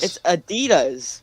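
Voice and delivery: "Adidas" stressed on the middle, a-DEE-das; American "Adidas", a-DEE-das; American pronunciation. Shown as a demonstration. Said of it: "say it properly".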